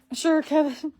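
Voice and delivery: high, loud mocking "Sure, Kevin"; sarcastic sure Kevin. Real, not acted. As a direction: roll your eyes at the scammer. Sarcastic jab at scammer.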